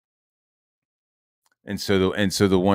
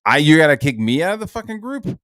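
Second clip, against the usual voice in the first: high, louder disbelieving repeat; incredulous echo; 9 semitones higher, 8 dB louder than usual. Retold or imitated. Retelling his reply to Ethan kicking him out, loud incredulous echo. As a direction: throw the question back in disbelief. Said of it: "starts D Ming me like".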